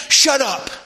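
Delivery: loud, high rebuke; crowd silencing him. Acted. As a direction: shout down the beggar as the crowd. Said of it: "people are saying".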